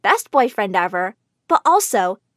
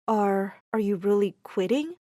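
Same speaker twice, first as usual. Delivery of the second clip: softer, hesitant and gentle; soft, hesitant question; 4 semitones lower, 7 dB quieter than usual. Acted. Tyler quietly asking his crying girlfriend, soft hesitant voice. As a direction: ask it gently, afraid of the answer. Tyler softly after hug.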